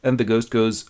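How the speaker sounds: usual speaking voice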